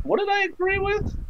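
high, baffled morning-after question; mock confusion. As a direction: play him waking up lost and confused. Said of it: "wake up tomorrow and be like".

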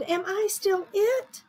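high, rising small voice; little fish voice. Acted. Little fish back from Sammy's belly asks, high-voiced. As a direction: ask like a tiny innocent kid.

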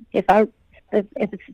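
normal talking voice

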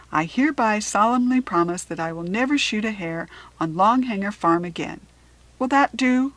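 emphatic solemn vow. A narrator's reading. Father promises daughter to spare hares, loud solemn vow. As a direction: proclaim the promise loudly and formally.

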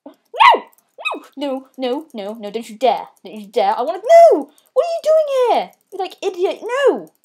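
panicked high yells; being chased. Real, not acted. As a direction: yell no, cornered. Enemy closing in on him.

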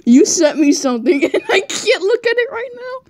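talking through giggles; laughing while speaking. Real, not acted. Laughing at sent picture.

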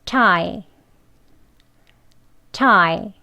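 tie said slowly, clearly; word drill. Shown as a demonstration. Teacher models tie twice for learners, careful drill. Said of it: "두 번 하겠습니다".

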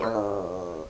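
low soft growl like a dog warning her off; a quiet, low little dog growl; imitated dog growl. Imitating the dog's low growl at the line. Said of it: "just a little".

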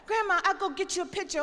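put-on a kid rushing to prove her point; eager granddaughter. Granddaughter eagerly offering grandma a picture. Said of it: "What the hell you know".

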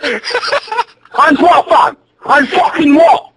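high-pitched angry challenge; real angry shout, drawing out the last words; mad. Real, not acted. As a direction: square up to them, pitched high and furious. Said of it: "You're so mad".

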